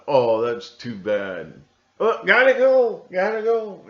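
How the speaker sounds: high, loud hurried "gotta go"; mock dismissive brush-off. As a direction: brush someone off cheerfully and flee. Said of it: "They'll just".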